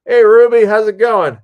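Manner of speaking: loud, high-pitched call through a door; over-eager greeting. Acted. Director knocks on the star's trailer, over-eager greeting. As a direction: holler a hello way up high.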